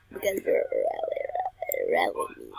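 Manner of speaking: exaggerated swooping voice. Character taunting the bully with a pitch swoop. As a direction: swing the pitch wildly to mock.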